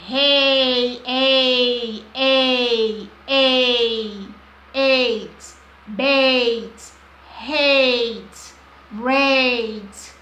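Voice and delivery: a loud drawn-out "ei", then bait, hate, rate with the same glide; long A sound. Shown as a demonstration. Teacher drilling the long vowel sound for pupils. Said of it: "um som longo".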